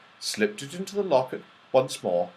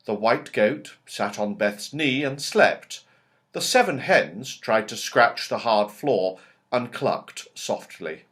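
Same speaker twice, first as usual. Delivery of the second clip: plain reading voice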